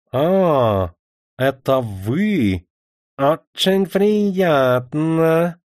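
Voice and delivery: slow, mannered, foppish tone; affected drawl. Acted. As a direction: stretch it out like a bored dandy. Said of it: "ломаным, вычурным тоном".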